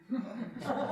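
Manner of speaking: soft, high chuckle; brief real chuckle at your own answer; laugh. Real, not acted. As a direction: quietly let a laugh slip out.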